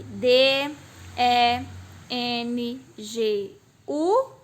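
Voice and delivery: D, E, N, G, U said slowly, letter by letter; spelled out. Shown as a demonstration. Teacher counting the letters of dengue for pupils, spelled out slowly. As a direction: spell D E N G U with a gap between letters. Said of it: "Letra é uma coisa".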